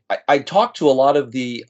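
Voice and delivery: ordinary talk, nothing marked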